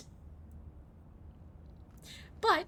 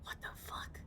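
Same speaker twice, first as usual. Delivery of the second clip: unvoiced whispered curse; 13 dB quieter than usual. Real, not acted. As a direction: whisper it so nobody hears. Hiding quietly from monster.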